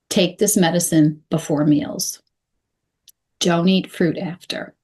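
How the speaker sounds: "Take this medicine before meals", full pause, "Don't eat fruit after"; two short sentences. Shown as a demonstration. Coach simplifying instructions for a patient, two short sentences. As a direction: give each instruction separately with a clear stop between. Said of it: "That can be broken down into".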